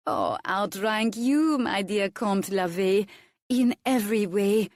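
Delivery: slow purring, a touch of a French lilt; purred. A narrator's reading. Vicomtesse purring a barb at Levet, slow and silky. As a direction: purr it like a cat toying with him. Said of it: "She purred it".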